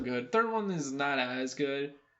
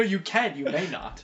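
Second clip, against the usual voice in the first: ordinary delivery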